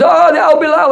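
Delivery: acted cry of alarm at a monster; mock scream. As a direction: feign terror in a high voice.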